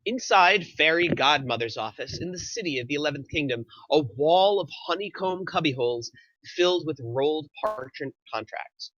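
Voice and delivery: ordinary talk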